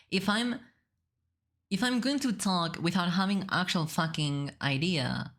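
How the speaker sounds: ordinary talk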